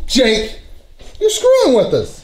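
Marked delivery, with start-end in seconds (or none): 1.2-2.2 high-pitched accusation; accusing. Real, not acted. Accusing Jake of pranking everyone, high insistent voice. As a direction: throw the accusation in a raised pitch.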